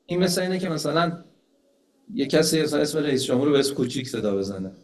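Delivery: ordinary talk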